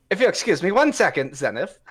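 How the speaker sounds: breezy rogue excuse; quick, high polite excuse. Acted. Rogue cat excusing himself to Zenith mid-fight, quick and high.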